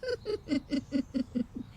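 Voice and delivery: scheming adviser's gloating chuckle; sly laugh. Acted. Adviser gloats to himself at the sarpanch's house, sly chuckle. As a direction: laugh like a plotter whose trick worked. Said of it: "सलाहकार कहता है".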